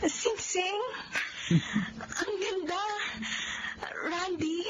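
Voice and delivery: tearful high gasps of joy; crying with joy. Acted. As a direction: weep happily as the ring appears. Proposal, she weeps with joy. (umiiyak sa tuwa)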